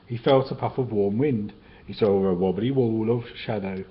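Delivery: ordinary delivery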